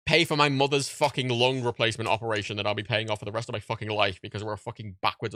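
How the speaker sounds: usual speaking voice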